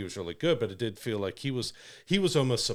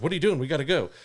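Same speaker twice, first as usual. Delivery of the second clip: higher, louder mock urgency; urgent hurrying; 5 semitones higher, 4 dB louder, much faster than usual. Interrupting him as he tries to charge it, urgent. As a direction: imitate someone impatiently hurrying him along.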